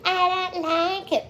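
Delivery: high, slow put-on voice; mock complaint. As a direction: fake a snooty dislike. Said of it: "It would be really fucking weird if I was like".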